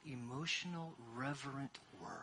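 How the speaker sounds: ordinary delivery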